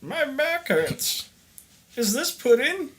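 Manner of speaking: put-on high, creaky old man complaining; a cranky old man with a bad back. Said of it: "I better have an old guy voice".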